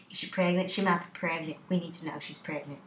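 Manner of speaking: hushed conferring, fading away; fevered whispers. Acted. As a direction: confer urgently under your breath. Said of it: "They talked in fevered whispers".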